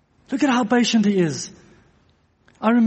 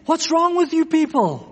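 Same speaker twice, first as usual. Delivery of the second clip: higher, indignant rebuke at the audience; retold angry outburst; 8 semitones higher than usual. Sproul scolding a Q and A questioner, mock angry rebuke. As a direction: flare up as if scolding the whole crowd. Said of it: "R C Sproul getting so angry".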